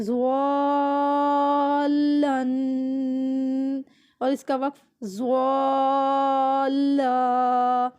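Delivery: زوالن held long, then زوالا as the pause form; stretched recitation. Shown as a demonstration. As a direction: draw زوالن out, end on زوالا. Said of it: "اٹھا کے پڑھنا ہے". (زوالن لمبا پڑھیں، وقف میں زوالا)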